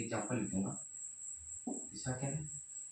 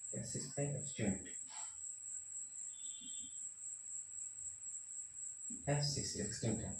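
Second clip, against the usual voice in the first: whispered to himself while writing; under the breath, barely voiced; muttered aside; 2 semitones higher, 13 dB quieter than usual. Real, not acted. Teacher mutters to himself at the board, whispered.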